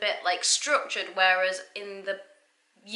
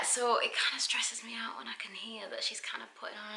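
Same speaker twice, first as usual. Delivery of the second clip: hush, admitting a criticism; confiding whisper; 2 semitones higher, 10 dB quieter than usual. Real, not acted. Host confiding criticism of the lead to viewers, whispered. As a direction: whisper it like a guilty secret.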